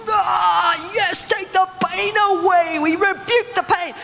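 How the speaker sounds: high-pitched hammed-up intercession; strained, theatrical rebuking cry; mock loud prayer. Shown as a demonstration. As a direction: parody an over-the-top prayer warrior. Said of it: "No, no, it wasn't like that".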